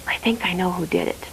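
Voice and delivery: hushed, secretive whisper. Acted. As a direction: breathe it like a tip-off. Guard confides to priest.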